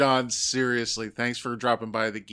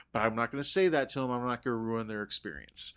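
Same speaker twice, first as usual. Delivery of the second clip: usual speaking voice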